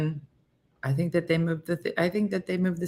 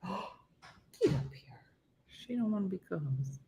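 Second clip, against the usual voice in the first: normal talking voice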